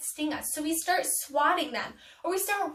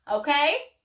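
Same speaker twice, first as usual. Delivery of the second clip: ordinary talk, nothing marked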